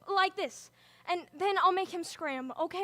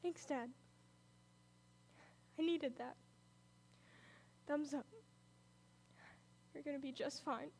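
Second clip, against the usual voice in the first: soft relief; 3 semitones lower, 14 dB quieter than usual. Acted. Father recovers, she comforts him.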